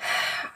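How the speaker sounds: long tired sigh; a soft defeated sigh; weary sigh. Acted. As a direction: let out a heavy breath before starting. Weary sigh before telling.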